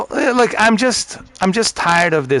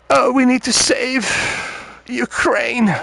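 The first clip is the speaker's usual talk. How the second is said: much higher, mock-earnest lament; mocking the rich lady; 10 semitones higher, slower than usual. Comic mimics the rich lady again, much higher. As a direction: ham up a pampered lady's plea. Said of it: "even that lady this morning".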